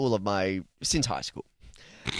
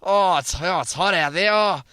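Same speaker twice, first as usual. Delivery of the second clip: hammed-up Australian accent, higher and louder; ocker Australian; 5 semitones higher, 4 dB louder than usual. Hosts riffing on Aussie clichés, hammed-up Australian. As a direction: ham up the ocker bloke. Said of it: "Put another shrimp on the barbie".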